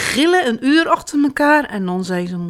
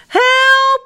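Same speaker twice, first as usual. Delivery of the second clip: high, loud, drawn-out cry; crying for help; 7 dB louder than usual. A narrator's reading. Jip stuck up the apple tree, crying for help. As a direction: yell it out in alarm. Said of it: "roept hij".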